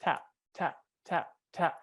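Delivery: soft, light taps in a quick steady beat. Shown as a demonstration. Coach shows the student light, fast pumping, soft even taps. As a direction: tap it out softly, like light little pumps. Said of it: "going to this one where it's tap".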